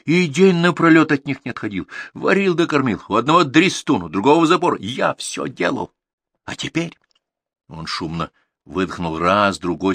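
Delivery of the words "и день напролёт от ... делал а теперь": high, heated list of grievances; indignant, higher-pitched tirade; angry shouting. A narrator's reading. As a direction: hurl each complaint in a raised voice. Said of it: "снова закричал Артур".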